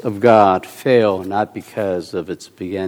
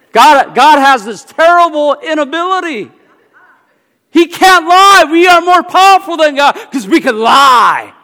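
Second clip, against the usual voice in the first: much higher, loud, fervent sermon voice; full-force preaching; 12 semitones higher, 6 dB louder than usual. Acted. Preacher proclaiming God cannot lie, at full force. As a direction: drive it home to the congregation.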